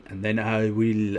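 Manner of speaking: put-on comic accent from 'Allo 'Allo, drawn out. Threatening to sink a ship and steal treasure, hammed-up 'Allo 'Allo accent. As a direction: menace them slowly in an 'Allo 'Allo accent. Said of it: "I love how you knew the accent just by me adding Z's".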